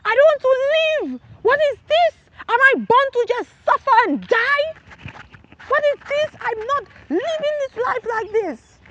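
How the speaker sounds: high, pleading anguished outcry; desperate cry. Retold or imitated. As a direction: beg to die, at the end of the rope. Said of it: "And I said".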